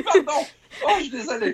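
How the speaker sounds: apologising through real giggles, pitch way up; laughing apology. Real, not acted. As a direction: say sorry while still cracking up. Apologising while still laughing.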